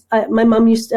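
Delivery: ordinary talk, nothing marked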